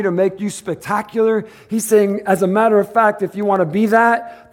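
high, loud, driving sermon voice; fiery preaching. Acted. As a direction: preach it out to the church. Preaching at full force.

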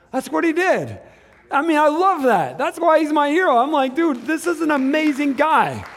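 loud, high, excited preacher's praise; fired-up preaching. Real, not acted. Preacher excitedly praising his hero.

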